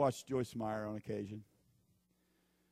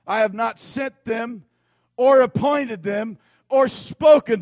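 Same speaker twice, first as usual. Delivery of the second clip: loud, slow scripture reading at full force; preaching scripture; 8 semitones higher, 15 dB louder than usual. Acted. Preacher reading Jeremiah aloud, loud and slow. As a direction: proclaim the verse loudly to the congregation.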